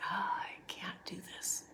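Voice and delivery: whispered, drained inner despair; exhausted whisper. Retold or imitated. Mother retelling her worn-out reaction to her crying daughter, whispered. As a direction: breathe it out like you've got nothing left. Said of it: "I'm like".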